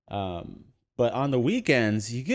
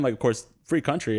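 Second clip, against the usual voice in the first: ordinary delivery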